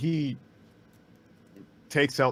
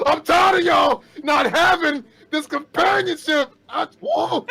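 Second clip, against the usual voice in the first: high, loud raging yell; furious shout; 11 dB louder than usual. Acted. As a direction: roar it out in fury. Franz raging while turning bear.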